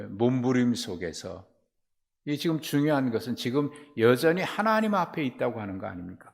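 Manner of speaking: normal talking voice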